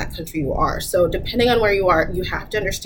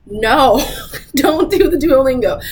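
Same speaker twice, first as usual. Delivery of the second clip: real laugh breaking into an emphatic no; laughing protest; 6 semitones higher, 5 dB louder, slower than usual. Real, not acted. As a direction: object loudly and higher, laughing. Laughing rebuttal to quoted learners.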